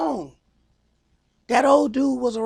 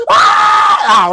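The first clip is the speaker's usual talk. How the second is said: loud mock scream of a child; 4 semitones lower, 7 dB louder than usual. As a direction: let out a hammed-up kid's yell. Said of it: "you hear".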